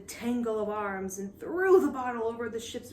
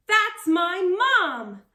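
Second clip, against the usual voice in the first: slow, much higher and louder sing-song; gleeful sing-song; 9 semitones higher, 9 dB louder, much slower than usual. Acted. Girl seeing her mother's ship, gleeful sing-song. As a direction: sing it out in triumph. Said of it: "Molly grinned".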